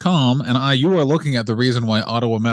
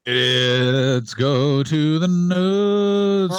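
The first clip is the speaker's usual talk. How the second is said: slow mock announcement; 3 semitones higher, much slower than usual.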